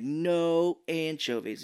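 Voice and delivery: slow, drawn-out ordering; slow insistence. Retold or imitated. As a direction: spell out the demand. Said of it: "he's like".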